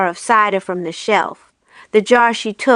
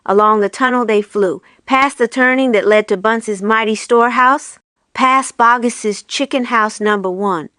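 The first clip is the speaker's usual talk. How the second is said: usual speaking voice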